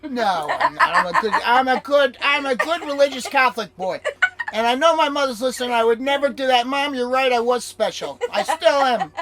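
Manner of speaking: laughing, loud and high. Real, not acted. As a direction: laugh through a mock-pious claim. Jokes about being a good boy.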